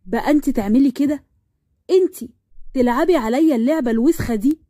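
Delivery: usual speaking voice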